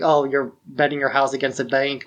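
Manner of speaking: ordinary talk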